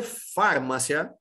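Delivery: "farmácia" said FAR-ma-sya, leaning on FAR; stress on first syllable. Shown as a demonstration. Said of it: "minha sílaba tônica ser a primeira". ("farmácia" com tônica na primeira sílaba, FÁR-ma-cia)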